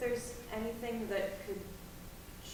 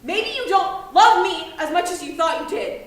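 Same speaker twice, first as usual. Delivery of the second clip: much higher, loud bitter yell; hurt furious yell; 7 semitones higher, 17 dB louder than usual. Acted. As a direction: hurl it at her. Teen shouts hurtful climax.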